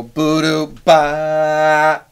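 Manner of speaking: sung figure that swells; crescendo to loud. Shown as a demonstration. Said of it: "you could put a forte at the end".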